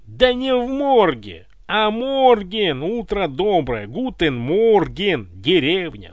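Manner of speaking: loud, drawn-out correcting; loud bickering. Acted. Guard corrects his mate's German greeting, loud bickering. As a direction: argue loudly, stretching the words.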